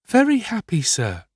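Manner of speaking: put-on high little boy's voice; young Oliver. A narrator's reading. Sick boy answers his kind host, high-voiced. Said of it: "replied Oliver".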